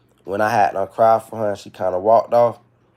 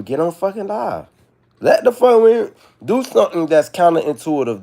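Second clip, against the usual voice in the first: pitched way up, loud and urgent; fired up; 8 semitones higher, 5 dB louder than usual. Real, not acted. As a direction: push them to act, voice rising high. Fired-up rant urging viewers on.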